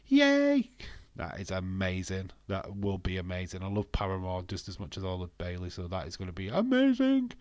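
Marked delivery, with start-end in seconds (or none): 0.1-0.7 high, loud yay; celebratory squeal of a word; excited cheer. Real, not acted. Cheers the entrance music news. 6.5-7.3 AMAZING leaps high and loud; excited gush. Real, not acted. Gushes, word leaps high.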